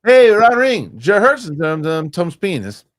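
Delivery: squeaky high mock foreign name-reading; high squeaky movie-quote voice. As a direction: read the names like a squealing mermaid.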